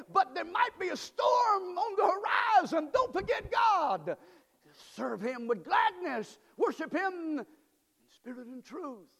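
high, loud pulpit exhortation; fiery preaching. Acted. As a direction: preach it to the back pew. Preaching at full force.

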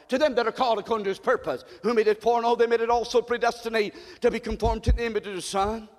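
usual speaking voice

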